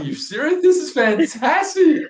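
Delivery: high, thrilled exclamation, stretched at the end; retold amazement. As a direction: relive the astonished delight. Retold reaction to finishing top six.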